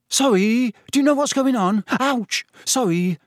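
put-on high, loud and flustered boy; nervous boy tripping in the dark; flustered Neville. Neville stumbling in darkness.

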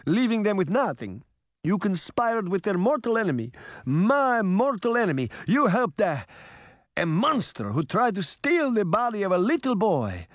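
put-on accented old father's high, emphatic scolding; stern, angry Geppetto. A narrator's reading. Geppetto confronts his son over betraying friends, indignant. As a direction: scold indignantly, punching the key words.

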